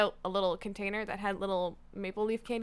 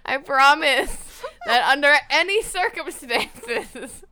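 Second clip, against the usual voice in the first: slow, loud, laughing through each word; laughing promise; 3 semitones higher, 7 dB louder, slower than usual. Real, not acted. Host promising her friend through laughter, slow laughing promise. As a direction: promise it while you can't stop laughing.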